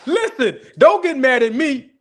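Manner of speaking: high and loud through laughter; laughing plea. Real, not acted. Streamer laughing at viewers, begging them not to get mad. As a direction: beg them through giggles.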